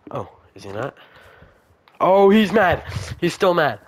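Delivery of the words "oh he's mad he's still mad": high, loud alarmed cry; startled yelp that he's mad; alarmed exclaim. Real, not acted. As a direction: panic as the golem turns. Golem still angry.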